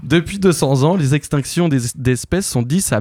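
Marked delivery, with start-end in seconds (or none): none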